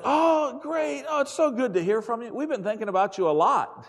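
high, loud mock delight; imitating an overjoyed wife on the phone; gushing phone voice. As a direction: gush at the caller, hammed-up.

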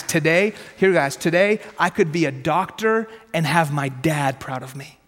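ordinary talk, nothing marked

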